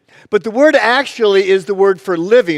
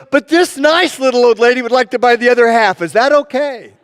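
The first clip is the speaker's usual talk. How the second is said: higher-pitched, hammed-up politeness to save face; hastily sweet cover-up; 6 semitones higher, 4 dB louder than usual. Clerk caught by the lady asks the owner, hammed-up innocent politeness. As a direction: recover from an insult with mock innocence.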